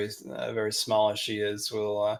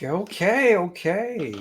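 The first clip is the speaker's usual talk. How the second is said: higher, rising-and-falling okays; cowed underling; 7 semitones higher, slower than usual. Acted. Thug answers Mugen's warning, cowed and higher. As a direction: give in to the threat with high-pitched okays. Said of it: "And he'll be like".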